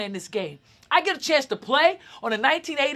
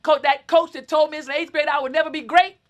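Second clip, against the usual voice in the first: usual speaking voice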